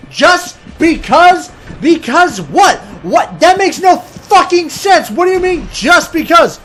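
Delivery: high-pitched comic rant; outraged disbelief. Acted. Caller demanding a real reason from agent, exasperated outrage. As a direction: fling it back, incredulous. Caller keeps ranting at the answer.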